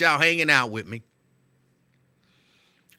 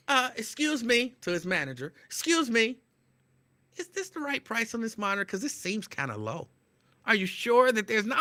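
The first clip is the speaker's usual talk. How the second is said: mock clerk voice; 8 semitones higher than usual. Imitating the clerk asking his manager about the price, high. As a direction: fuss over the price like a nervous clerk.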